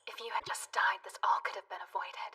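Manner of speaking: whisper. Acted. Menacing voice in his head.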